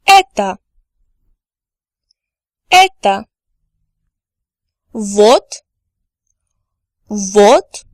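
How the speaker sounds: each Russian pronoun said clearly, then again; teacher's clear drill voice; slow repeat-after-me drill. Shown as a demonstration. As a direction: model pronunciation for learners to repeat. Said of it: "I'm going to repeat each word twice".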